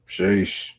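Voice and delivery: usual speaking voice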